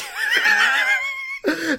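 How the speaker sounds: high squealing laugh; laughing fit. Real, not acted. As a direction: cracks up in high-pitched laughter. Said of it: "breaks up mid-attempt".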